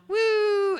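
long, high "wooo" sound effect; high swooping orbit noise; playful high "woo". Pretend. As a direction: whoosh out a high drawn-out "woo".

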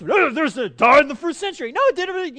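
high, loud, rapid babble of argument; hammed-up theological squabble; squabbling crowd. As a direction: act out people bickering over each other. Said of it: "then they go at it".

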